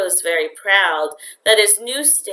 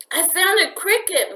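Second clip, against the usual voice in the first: put-on high, eager boyish exclamation; exclaiming; 12 semitones higher than usual. A narrator's reading. Boy showing his parents a cricket, excited exclaim. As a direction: blurt it out with delight at the find. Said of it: "Mario exclaimed".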